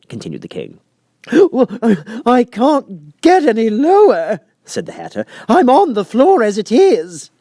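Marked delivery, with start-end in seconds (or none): 1.3-7.3 high, loud, slow quavering plea; trembling Hatter. A narrator's reading. Hatter pleads with the King, trembling high and slow. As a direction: beg for mercy, voice shaking.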